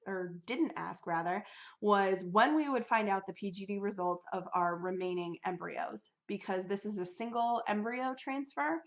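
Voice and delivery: ordinary talk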